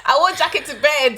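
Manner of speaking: high, loud laughing protest; comic outrage. Real, not acted. Telling friend about freezing at uni, outraged. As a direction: wail it in mock misery.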